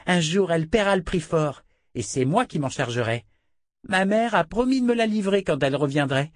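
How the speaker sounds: high, mocking little-girl voice; cruel child. A narrator's reading. Child princess taunting her captive Richard, high sneering voice. Princess gloats about the inquisitor.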